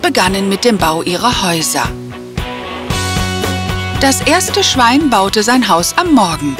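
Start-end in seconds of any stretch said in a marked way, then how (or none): none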